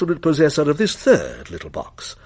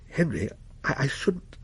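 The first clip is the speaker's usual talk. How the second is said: soft, hesitant warning to her husband; a quiet cautionary murmur, breaking off; wife quietly warning her husband to stop, softly; 10 dB quieter than usual. A narrator's reading. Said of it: "began Missus Mariner warningly".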